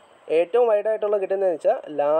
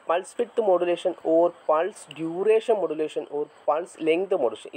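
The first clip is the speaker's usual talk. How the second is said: slow dictation; 2 semitones higher, much slower than usual. Shown as a demonstration. Teacher dictating a definition to the class, slow and measured. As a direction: dictate the definition word by word, very slowly.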